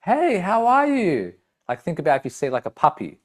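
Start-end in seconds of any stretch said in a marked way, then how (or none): 0.0-1.3 much higher, slow, bright-toned hello; high, drawn-out friendly hello; friendly greeting. Shown as a demonstration. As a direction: greet them like a happy friend. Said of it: "The pitch is higher".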